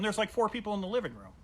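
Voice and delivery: ordinary talk, nothing marked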